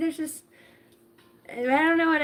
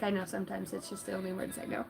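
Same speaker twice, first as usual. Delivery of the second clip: muttered excuse; 5 semitones lower, 14 dB quieter, faster than usual. Retold or imitated. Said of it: "and I'm like".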